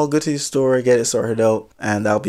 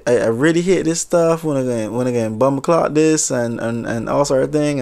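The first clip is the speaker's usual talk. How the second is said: put-on Jamaican accent. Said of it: "maybe I'll just try the accent just a little bit".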